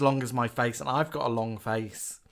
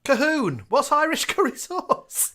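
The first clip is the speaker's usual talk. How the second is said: laughing call-out; 12 semitones higher, 6 dB louder than usual. Real, not acted. Calling out a listener's question.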